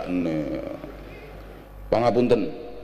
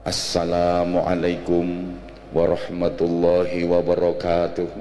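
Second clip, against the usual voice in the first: slow, drawn-out official greeting; formal speech greeting; 10 semitones lower, slower than usual. Shown as a demonstration. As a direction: stretch out warahmatullahi like a dignitary at the podium. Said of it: "Salamnya orang sambutan ya". (salam gaya pejabat sambutan, pelan dan panjang)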